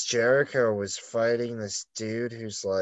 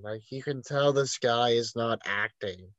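ordinary talk, nothing marked

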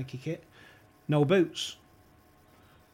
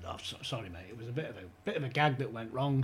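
sheepish apology; 4 semitones lower, 6 dB quieter, faster than usual. Retold or imitated. Embarrassed retraction phone calls.

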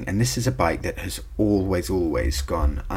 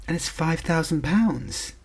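high, slow disbelief; astonished; 11 semitones higher, slower than usual. Real, not acted. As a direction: stretch the price out in shock. Shocked at cheap price.

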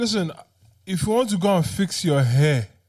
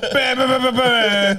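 imitated gunshots; 10 semitones higher, 8 dB louder, slower than usual.

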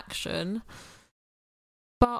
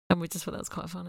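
ordinary talk

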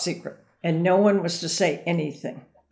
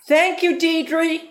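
much higher and louder shouted reply; yelled back; 11 semitones higher, 6 dB louder, much slower than usual. Retold or imitated. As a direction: shout back cheerfully from the car. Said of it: "And he yelled back".

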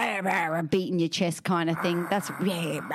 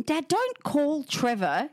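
slow, high, laughing telling-off; mock scolding; 8 semitones higher, slower than usual. Daughter telling dad off about the nickname, laughing. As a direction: stretch it out, half laughing.

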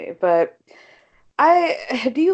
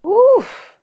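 loud high oof; 8 dB louder than usual. Real, not acted. Reacting to court news.

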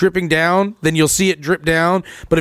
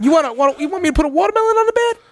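much higher, fast teasing squeak; high silly voice; 10 semitones higher, faster than usual. Pretend. Teasing co-host about pillows, high fast squeak. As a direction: tease him in a squeaky joke voice.

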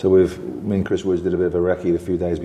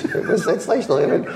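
laughing; 10 semitones higher than usual. Real, not acted. Laughing at funny story.

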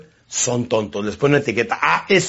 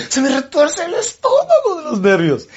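high, slow mock-anxious wife; nervous wife; 12 semitones higher than usual. Wife fretting over her husband's return, high and slow. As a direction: whine it out in a high voice. Wife's nervous stomach complaint.

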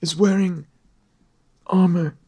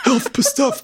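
mocking echo; 6 semitones higher, slower than usual. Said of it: "Put that on a t-shirt".

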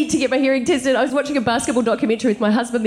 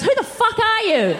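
baffled husband; 9 semitones higher than usual. Retold or imitated. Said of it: "my husband just looks at me and goes".

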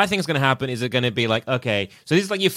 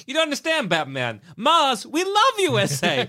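Borat voice; 11 semitones higher than usual. Pretend. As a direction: ham up a Borat accent, pitched way up. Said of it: "he's got Borat voice almost".

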